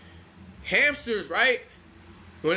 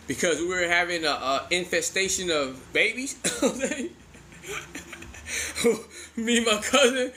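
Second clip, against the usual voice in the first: ordinary talk, nothing marked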